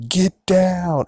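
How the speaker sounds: high, loud warning to the bystander; urgent shout. Retold or imitated. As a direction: yell it to make her duck. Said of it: "It's like".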